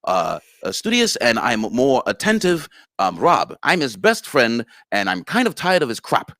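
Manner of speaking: Rob. Shown as a demonstration. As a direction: tighten the voice and lift it, emphatic. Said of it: "tighten my voice a little bit and get a little higher".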